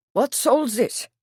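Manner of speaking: put-on lightly German-accented prince; a touch of guttural German accent. A narrator's reading. Prince questioning the stable men, guttural German accent. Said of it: "asked in a deep guttural voice with a German accent".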